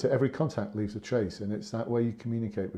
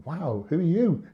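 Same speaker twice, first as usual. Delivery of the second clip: slow, high, loud mock astonishment; acted amazement; 7 semitones higher, 6 dB louder than usual. Sergeant silently marvelling at the man who rescued him, hammed-up amazement. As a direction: stretch it out like you're amazed by a stranger. Retelling his amazed inner reaction.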